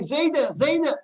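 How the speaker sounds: mock terrified child screaming, high and loud; a child's retold scream for his grandfather; screaming in terror. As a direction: shriek for grandpa like a scared child. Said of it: "he's screaming in terror".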